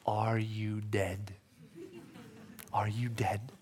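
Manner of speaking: slow, soft, then whispered; gentle teasing Jesus. Acted. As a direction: ask it slowly, almost smiling. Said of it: "he's gonna say these words".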